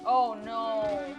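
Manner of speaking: ordinary talk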